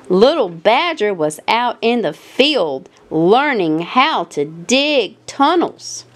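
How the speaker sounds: usual speaking voice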